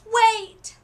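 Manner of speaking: high, loud desperate call; crying out. A narrator's reading. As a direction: stop her before she leaves. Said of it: "she cried".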